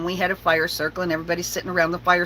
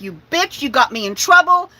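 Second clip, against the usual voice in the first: higher, louder grumbling dog voice; dog storming off after being blamed, huffy and raised; indignant dog; 6 semitones higher, 5 dB louder than usual. Retold or imitated. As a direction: complain like a wronged good boy. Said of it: "kept walking away like".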